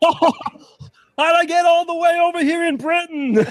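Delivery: very high, drawn-out impression whine; comic bewildered character. Host plays a character lost in Britain, high drawn-out bafflement. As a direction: wail it slowly, way up high.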